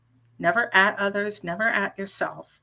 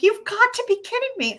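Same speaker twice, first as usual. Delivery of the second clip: incredulous; 14 semitones higher, faster than usual. Retold or imitated. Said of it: "I'm like".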